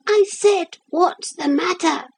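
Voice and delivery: very high-pitched tiny figure; little voice. A narrator's reading. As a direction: pipe up in a tiny voice. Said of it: "said the little voice".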